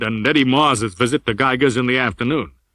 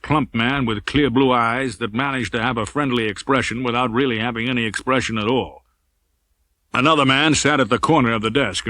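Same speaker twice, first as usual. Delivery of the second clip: normal talking voice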